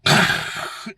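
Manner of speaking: harsh rasping imitation of grinding gears; mouth-made gear crunch; imitated grinding noise. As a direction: make a horrible grinding, clicking car noise. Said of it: "the most horrible grinding, just terrible clicking".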